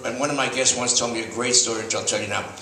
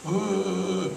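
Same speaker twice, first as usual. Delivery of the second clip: put-on vague old woman's ohs; 2 semitones lower, 4 dB quieter, slower than usual. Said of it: "she goes".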